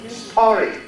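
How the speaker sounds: "Historic" stressed on the middle, his-TOR-ic; stress on second syllable. Shown as a demonstration. Teacher models the correct stress, "Historic" said his-TOR-ic. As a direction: put the weight on TOR in "Historic". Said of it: "On the second".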